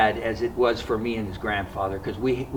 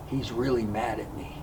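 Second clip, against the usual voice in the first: softer, hushed so the grandfather can't hear; 10 dB quieter than usual. Retold or imitated. Telling grandma the grandfather is angry, softly. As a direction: say it under your breath to the grandma. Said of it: "in a very soft voice so he couldn't hear".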